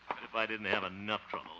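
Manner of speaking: ordinary talk, nothing marked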